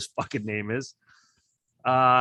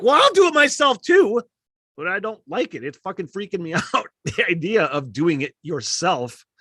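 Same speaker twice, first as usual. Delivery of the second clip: panicky retort; 6 semitones higher, 5 dB louder than usual. Retold or imitated.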